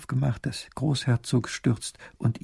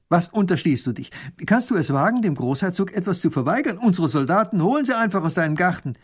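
stern; 6 semitones higher, 4 dB louder than usual. Acted. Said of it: "sagt streng".

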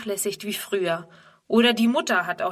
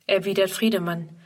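plain reading voice

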